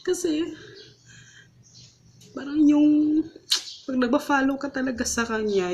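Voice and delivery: pushing words out while crying; tearful. Real, not acted. Crying while telling testimony. (umiiyak habang nagsasalita)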